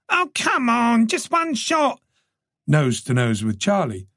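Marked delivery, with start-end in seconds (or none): 0.1-2.0 high, wheedling reporter voice; pleading journalist. A narrator's reading. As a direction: beg for one more photo.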